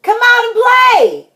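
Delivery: high, loud holler to a friend; singing-high shout. A narrator's reading. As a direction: call the friend out from outside the house. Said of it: "he hollered".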